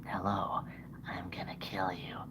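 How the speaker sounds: whispered menacing little puppet voice; hushed murderous puppet whisper; puppet Hoppy. Pretend. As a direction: whisper a threat as the bunny puppet. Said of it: "Say hi, Hoppy".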